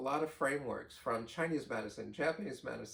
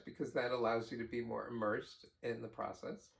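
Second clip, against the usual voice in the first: ordinary delivery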